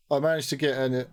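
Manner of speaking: ordinary delivery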